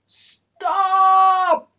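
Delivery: loud, high trumpeting stop; trumpeted. Acted. Elephants trumpeting at the peacock, loud and high. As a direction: blare it like an elephant. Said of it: "trumpeted the elephants".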